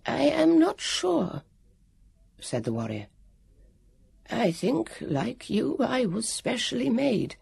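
high, slow, uncertain; unsure warrior. Acted. The warrior unsure what he is, high and slow. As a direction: muse on your own making.

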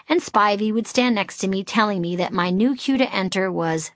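plain reading voice

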